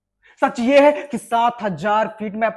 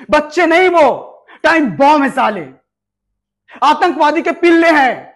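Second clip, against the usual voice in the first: louder, higher contemptuous outburst; angry shout; 3 semitones higher, 5 dB louder than usual. Acted. Officer shouts at the major about the children, furious. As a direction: spit the insult at him.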